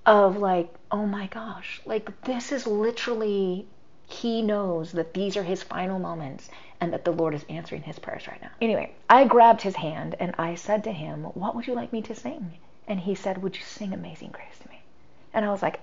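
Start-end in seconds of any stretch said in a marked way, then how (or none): 0.9-8.5 awed hush. Retold or imitated. Inner awe at sacred moment. 11.3-14.8 soft bedside hush. Retold or imitated. Quiet bedside exchange.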